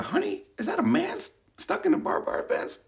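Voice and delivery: a driver nudging a partner at the sight; imitating a passing driver. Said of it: "Everybody been".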